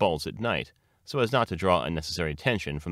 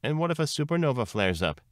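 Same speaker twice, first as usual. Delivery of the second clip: high-pitched restless worry; 9 semitones higher than usual. A narrator's reading. Neurosis presses Lily Bunny about supernova, restless. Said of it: "asked restlessly".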